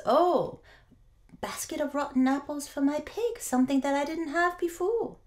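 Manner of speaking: high, bright-eyed delight over a bad bargain; delighted farmer. Farmer thrilled with his rotten apples, speaking high and eager. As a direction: gush at the rotten apples like treasure.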